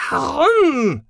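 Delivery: loud, very high shouted question; piercing, angry cry; furious demand. A narrator's reading. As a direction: fling the word out in rising fury. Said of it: "Sahira wurde immer wütender".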